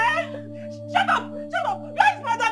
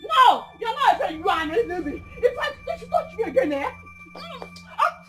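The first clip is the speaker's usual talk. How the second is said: weeping curses. Acted. Grieving mother curses her dead daughter's friend, sobbing. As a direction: sob and shout, breaking down between words.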